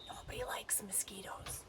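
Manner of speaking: unvoiced whisper, like a secret; whispered aside. Real, not acted. Teacher whispering a joke to the kids.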